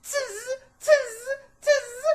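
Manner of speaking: high loud screechy monkey chatter. A narrator's reading. Monkeys mocking the furious peddler, loud high chatter. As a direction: screech back like wild monkeys. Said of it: "the monkeys ... said".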